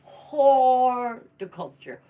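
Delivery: horticulture drawn out very slowly, loud and high; mock-grand mispronunciation. Shown as a demonstration. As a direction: boom out Horticulture slowly as a silly in-joke. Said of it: "You guys remember that?".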